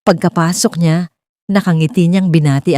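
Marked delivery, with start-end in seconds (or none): none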